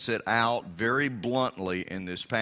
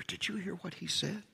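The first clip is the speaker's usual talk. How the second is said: whispering; 6 semitones higher, 9 dB quieter than usual. Retold or imitated. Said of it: "people whispering".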